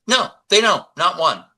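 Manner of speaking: high-pitched, emphatic mock denial; insistent denial. Video doctor answering a doubting viewer, insistent denial.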